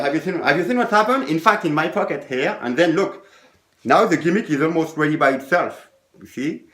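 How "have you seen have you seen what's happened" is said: quick patter. Shown as a demonstration. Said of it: "I do it again with the timing".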